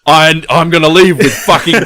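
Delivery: laughing mock vow. Acting out the greedy guy.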